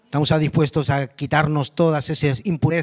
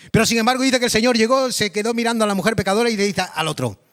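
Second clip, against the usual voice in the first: ordinary talk, nothing marked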